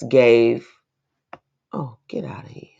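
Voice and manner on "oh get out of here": breathy disbelieving whisper under the breath; whispered aside. Real, not acted. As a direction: mutter it to yourself in disbelief. Reacting in disbelief to article.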